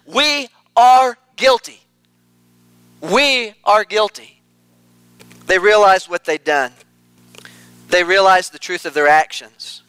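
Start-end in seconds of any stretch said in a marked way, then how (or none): 0.1-4.4 slow confession. Retold or imitated. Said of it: "the brothers and they say".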